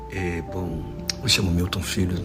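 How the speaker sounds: usual speaking voice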